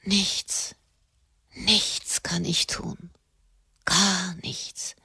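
a soft, slow whisper; despairing whisper. A narrator's reading. Katharina whispering her helplessness to the friars. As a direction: barely breathe the words out, slowly.

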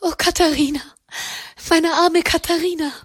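high, distraught lament; verweinte Klage. A narrator's reading. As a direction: cry out in pity, voice pitched high. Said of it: "mit rot geweinten Augen hereingestürzt". (hohe, verzweifelte Klage)

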